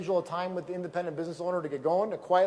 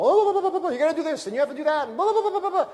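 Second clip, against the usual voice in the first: high, loud mocking babble of a pushy recruiter; hammed-up sales pitch gushing; 9 semitones higher, 6 dB louder, faster than usual. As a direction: rattle it off like an overeager salesman dumping on someone. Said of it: "they're throwing up all over people".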